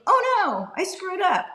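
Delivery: high-pitched panicked cry of a surgeon; hammed-up panic, high and sudden; mock scream. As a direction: yelp it like a surgeon who just slipped. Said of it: "and you scream".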